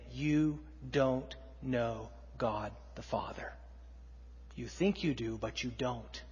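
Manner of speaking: low, whispered rebuke; hushed confrontation. Acted. Jesus telling the Pharisees they do not know God, in a low whisper. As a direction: hiss it quietly into their faces.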